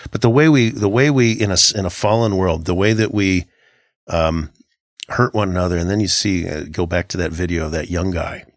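usual speaking voice

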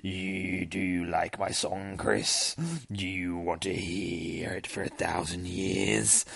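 low, soft, drawn-out monster voice; sinister demon. Acted. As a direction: taunt slowly, stretching the words. Voices the singing demon again.

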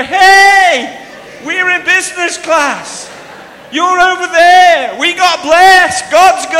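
full-voiced high, gleeful holler; shouting. Friend shouting to the queue from business class, loud and boastful. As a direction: shout it over a crowd, slow and high. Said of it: "You can't be shouting over".

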